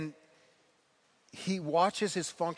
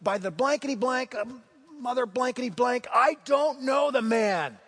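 higher, louder panicked oath, slowing at the end; cursing denial; 6 semitones higher, 5 dB louder than usual. Acted. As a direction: swear you never knew him, desperate. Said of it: "begins to call down curses on himself".

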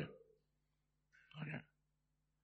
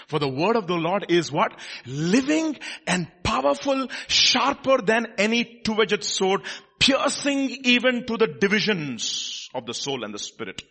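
loud, high-pitched, driving proclamation; full-force preaching; 10 semitones higher, 9 dB louder, slower than usual. Real, not acted. Preacher tells people genuine prophets will weep, full-force preaching. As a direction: preach it hard, stretching key words.

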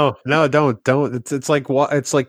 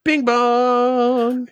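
sing-song bing bong; 10 semitones higher than usual. Acted. Playful podcast intro jingle voice.

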